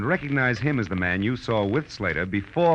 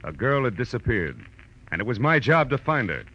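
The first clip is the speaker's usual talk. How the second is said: dramatic teaser narration; 5 semitones higher than usual. A narrator's reading. Detective teases next week's case to listeners, brooding and dramatic. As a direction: build suspense line by line over the rain.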